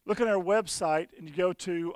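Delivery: ordinary talk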